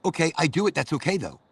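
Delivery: normal talking voice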